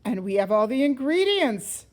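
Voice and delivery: loud, lightly voiced Papa Bear; big bear voice. A narrator's reading. Papa Bear offers the ingredients, lightly done big loud voice. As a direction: boom it a little. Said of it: "said Papa Bear in his big voice".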